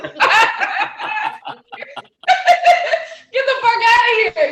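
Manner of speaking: high, loud mock exasperation through laughter; hammed-up laughing yell; laughing shout. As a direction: blurt it out laughing. Laughing outburst.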